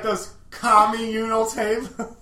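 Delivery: "communal" slow, with a drawn commie-style "kʰɑmi". Retold or imitated. Friend says "communal" like commie, slowly. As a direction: stretch "communal" so it starts like commie. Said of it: "he throws it like commie".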